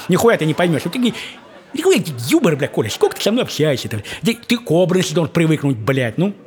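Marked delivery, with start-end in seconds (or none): none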